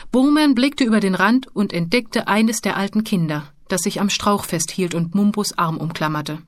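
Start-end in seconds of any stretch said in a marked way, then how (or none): none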